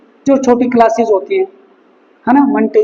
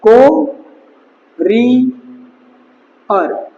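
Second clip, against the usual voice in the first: drawn-out word; much slower than usual. Shown as a demonstration. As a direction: stretch courier out very long and slowly. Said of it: "बस ये यहीं से relate हो जाएगा अपना".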